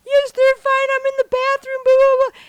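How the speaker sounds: very high, loud hysterical wail; mock crying. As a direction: blubber it in a hammed-up meltdown. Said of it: "I'm crying".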